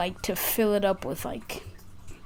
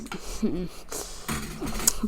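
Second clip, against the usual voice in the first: breathy laugh; 9 dB quieter than usual. Real, not acted. Laughs at own blooper.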